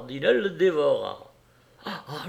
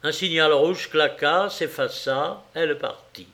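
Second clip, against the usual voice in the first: plain reading voice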